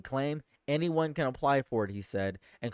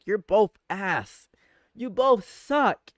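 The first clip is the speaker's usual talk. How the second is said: fed up; 7 semitones higher, 7 dB louder, slower than usual. Real, not acted. Streamer insulting both parties.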